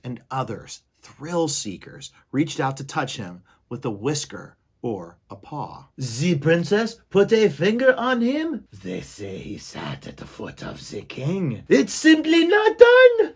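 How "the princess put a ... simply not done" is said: a high, loud, scandalized aunt; Aunt Florence. Acted. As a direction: gasp in disapproval like a prim aunt. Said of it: "the distinctive voice of Despero's Aunt".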